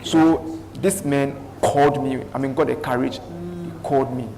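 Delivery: ordinary talk, nothing marked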